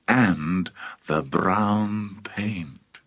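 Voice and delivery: very slow, hushed finish; drawn out. A narrator's reading. Narrator capping a long list of ingredients, drawn out. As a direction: stretch the words out slowly, half-whispered. List builds to its last item.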